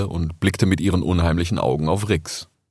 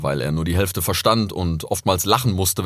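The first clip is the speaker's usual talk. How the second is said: ordinary delivery, nothing marked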